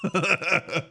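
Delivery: short high laugh of ridicule; scornful laugh; mocking chuckle. Real, not acted. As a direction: chuckle at him. Laughs at protester.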